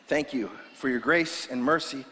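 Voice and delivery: soft, hushed closing prayer. Acted. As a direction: pray quietly over the congregation.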